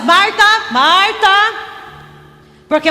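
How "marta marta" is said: slow, stretched name with a rising end; calling her to attention. Acted. Preacher imagining Jesus interrupting Marta, calling her to attention. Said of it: "E se ele falasse".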